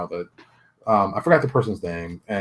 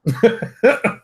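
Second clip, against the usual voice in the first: short high-pitched laugh; real amused chuckle; 11 semitones higher, 4 dB louder than usual. Real, not acted. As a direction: burst out in a brief high laugh. Breaks off amused after pause.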